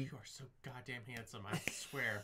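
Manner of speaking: breathy unvoiced whisper of a doting wife; mock whisper. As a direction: whisper the compliment under your breath, teasing. Mocking wife's sweet aside.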